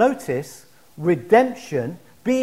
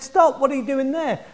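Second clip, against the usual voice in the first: lightly voiced preacher calling a halt, higher; challenging preacher; 6 semitones higher than usual. Preacher stopping a boy swinging a bird cage, challenging. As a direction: step in with a higher-pitched challenge.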